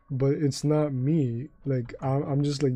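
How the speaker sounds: ordinary delivery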